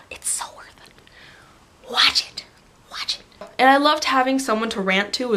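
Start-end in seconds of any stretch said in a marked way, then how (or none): 0.1-3.2 intense, drawn-out whispered plea; urgent whisper. Real, not acted. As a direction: whisper it like a vital recommendation. Urging viewers to watch.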